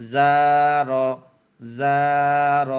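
teacher calls out the blend for students to repeat; emphatic demo. Shown as a demonstration. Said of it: "জা খালি আলিফ জবর জা".

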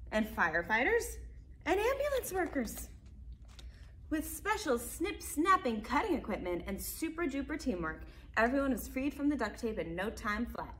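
lively picture-book narration; reading to children. A narrator's reading. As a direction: read it out brightly to small kids. Reads picture book aloud.

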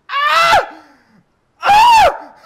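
high loud squealing yelps; dolphin cry. Pretend. Imagining a dolphin yelling at a beachgoer, high loud shrieks. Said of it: "a dolphin comes up to you and is like".

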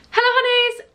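high, loud sing-out hello; over-bright channel opener; vlog intro greeting. Shown as a demonstration. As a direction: put on a big bubbly intro voice. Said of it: "That's annoying me".

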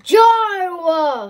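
high shout of the name. Real, not acted.

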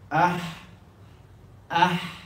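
Ah breathed from deep in the throat; throaty ha. Shown as a demonstration. Teacher showing the throat ha sound. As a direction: push the Ah up from the throat. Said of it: "from the deep of the throat".

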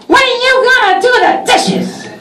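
very high, piercing put-on wife; nagging wife voice. Wife nagging Santa about the dishes, very high mock voice. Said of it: "Missus Claus turns to me and says".

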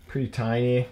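ordinary delivery

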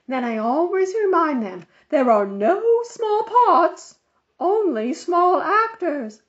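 high, loud bossy lady's voice; fussy Mrs Armstrong. A narrator's reading. Busybody on the phone lecturing Mother, high and loud. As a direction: play the busybody organizer insisting.

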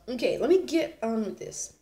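ordinary talk, nothing marked

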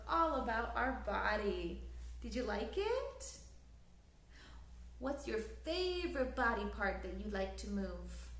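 ordinary delivery, nothing marked

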